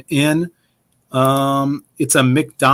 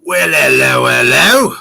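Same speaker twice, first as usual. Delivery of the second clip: hammed-up Cockney greeting; broad mock-Cockney, a little loud; big Cockney accent; 5 dB louder than usual. Shown as a demonstration. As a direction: lay the Cockney on thick. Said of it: "big Cockney".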